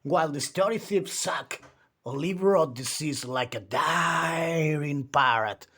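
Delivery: plain reading voice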